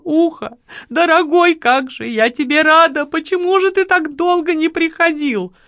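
high, plaintive wail of relief; tearful outburst. A narrator's reading. Lola throws herself at her rescuer, wailing in relief. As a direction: gush with relief, pitched high. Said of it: "бурно разрыдалась".

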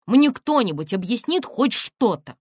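громко, высоко, с расстановкой; потребуй объяснений, теряя терпение; возмущённое требование. A narrator's reading. Тонечка теряет терпение, требует объяснений. (возмущённо, нетерпеливо)

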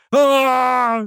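long high-pitched scream; drawn-out yell of terror; loud terrified scream. A narrator's reading. Fat captive threatened with a knife, screaming. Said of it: "заорал Толстый, что есть мочи".

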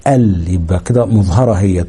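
ordinary talk

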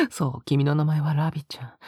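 ordinary talk

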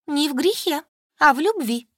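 high, emphatic defiant retort; bold declaration. A narrator's reading. The pregnant friend answers the mother back: high, bold and defiant. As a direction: stand up to her, high and proud. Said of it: "вдруг осмелела ... и заявила".

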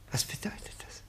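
breathless hushed whisper; man whispering a suspicious question. Acted. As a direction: ask it under your breath.

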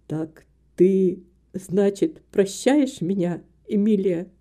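low, halting male character voice; hesitant man. A narrator's reading. Philip asks Emilia's forgiveness, low and hesitant. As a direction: ask haltingly, pitched low.

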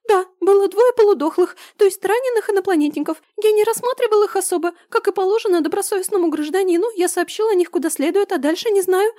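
high voice rattling off excuses; hurried squeaky babble. Acted. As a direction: rush the lie out nervously. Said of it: "торопливо бормотал этот лживый гад".

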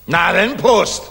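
loud, high, rising toast call; hearty toast. Acted. General toasting his friend with a loud cheer. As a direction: raise the glass and bellow it out.

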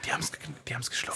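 fast conspiratorial whisper. Acted. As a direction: whisper it so the crowd can't hear. Secretly gloating after the trick.

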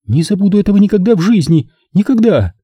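high, fast, shaken cry; a trembling outburst; trembling exclamation. A narrator's reading. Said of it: "воскликнула Нина дрожащим голосом". (дрожащим голосом воскликнуть)

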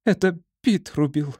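high sobbing squirrel, teeth chattering. A narrator's reading. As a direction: sob it out through chattering teeth. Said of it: "Поня всхлипнула, стуча зубами".

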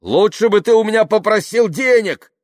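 high, loud, indignant outburst; yelling. A narrator's reading. Yuklin accusing his acquaintance of spying for money, yelling. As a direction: keep bellowing the reproach. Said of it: "продолжал орать Юклин".

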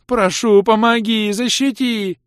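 high, loud, slow creature sobbing plea; tearful high creature begging; wailing plea. A narrator's reading. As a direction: wail it, clinging to his trousers. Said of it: "заплакал Водяной".

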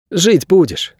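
put-on high-pitched boy; boy's voice. A narrator's reading. Boy glancing at the injured back, high boyish voice. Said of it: "сказал мальчик".

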